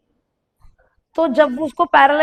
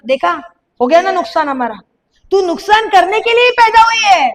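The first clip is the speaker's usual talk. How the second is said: scolding; 5 semitones higher, 5 dB louder than usual. Retold or imitated. Mother scolding child over broken cup.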